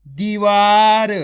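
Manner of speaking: दीवार very slow, long vowels held; drawn-out reading. Shown as a demonstration. Teacher joins the letters into a word, drawn-out दीवार. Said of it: "आपको खींच के पढ़ना है".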